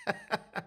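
high squealing laugh; burst of laughter. Real, not acted. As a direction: crack up suddenly. Laughs at own chat joke.

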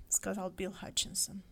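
ordinary delivery, nothing marked

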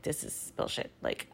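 low, quiet, pissed mutter; pissed inner remark. Retold or imitated. Shopper fuming to herself after feeling profiled, pissed and muttering. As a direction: grumble it low under your breath. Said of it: "so I'm pissed now".

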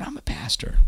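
excited whispered reply; hushed whisper. Retold or imitated. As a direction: whisper it back to your neighbour in line. Whispering to pastor in line.

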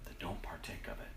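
breathy whispered warning. Real, not acted. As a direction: whisper it as a solemn caution. Urging listener quietly.

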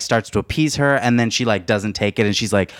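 normal talking voice

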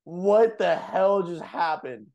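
mock-stunned outburst; retold shock. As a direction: stretch it out, imitating stunned friends. Retelling everyone's shock after the crash.

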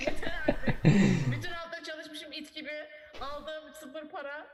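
laughing mock rant; half-laughing fake tantrum; mock outburst. Mock outburst over work.

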